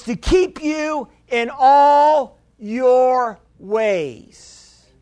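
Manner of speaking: very slow, each word weighed; slow emphasis. Real, not acted. Pastor stressing a verse to the congregation, very slow. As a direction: read it slowly so they underline it. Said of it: "Underline this".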